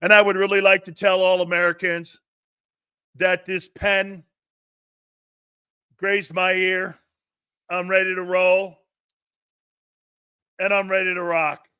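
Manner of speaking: mock acceptance speech. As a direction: address all Americans like a hammed-up nominee. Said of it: "my acceptance speech".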